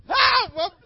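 very high, loud desperate screams; screaming for help. Retold or imitated. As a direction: scream like the plane is going down. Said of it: "I'm screaming".

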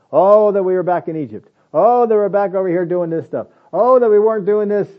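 high, loud whining complaint; whining grumble. Retold or imitated. Israelites in the wilderness, loud whining complaint. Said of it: "They grumbled and complained".

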